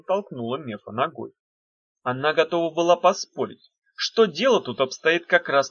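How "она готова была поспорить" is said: usual speaking voice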